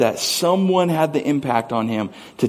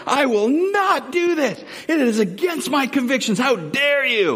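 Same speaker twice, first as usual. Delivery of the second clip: mock outraged blow-up, much higher and slow; imitating a furious friend; 11 semitones higher than usual. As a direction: ham up a friend exploding over his convictions. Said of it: "he would get so mad".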